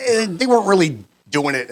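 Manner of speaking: usual speaking voice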